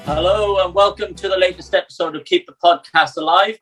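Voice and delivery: ordinary talk, nothing marked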